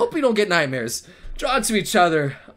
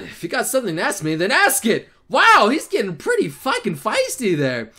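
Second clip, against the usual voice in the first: ordinary talk, nothing marked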